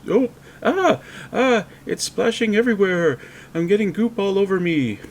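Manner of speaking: sudden high, loud yelps of disgust; grossed-out yelp. Real, not acted. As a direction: yelp as the goop splashes you. Goop splashing on him.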